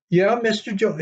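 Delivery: high, falling voice for the wife echoing Mister Jones; puzzled wife. Retold or imitated. Wife echoes the driver's question, high and puzzled. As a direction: echo it back high and bemused.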